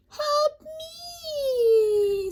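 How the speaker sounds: very high, far-off cry of help me. Acted. Man stuck beside his pig, very high cry for help. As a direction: wail for help.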